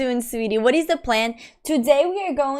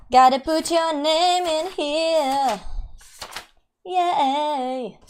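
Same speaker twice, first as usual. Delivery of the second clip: "Gotta put your name in here" slow and higher, sung out; sing-song; 4 semitones higher, much slower than usual. Shown as a demonstration. Streamer entering a giveaway name in a slow sing-song. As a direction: stretch "name" playfully, up high.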